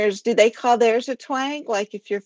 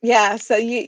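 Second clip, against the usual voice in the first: ordinary talk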